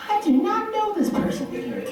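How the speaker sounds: high-pitched deadpan denial of a friend; mock disowning.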